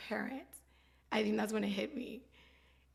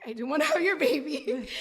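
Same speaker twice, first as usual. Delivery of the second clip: laughing quote; 4 semitones higher, 4 dB louder than usual. Retold or imitated. Laughing retelling of her declaration.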